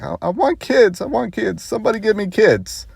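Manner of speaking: high-pitched imitated wailing plea; hammed-up tearful pleading without real sobs; mock crying. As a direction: whine it high, as if tearfully begging. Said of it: "she just starts crying".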